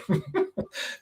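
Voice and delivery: soft unvoiced laugh of pride; choked little laugh; breathy emotional laugh. Real, not acted. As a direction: let out a quiet laugh. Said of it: "I almost cried".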